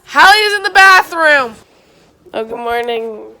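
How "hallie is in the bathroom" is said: loud and high call. Real, not acted. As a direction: shout it across the house. Calling out about sister.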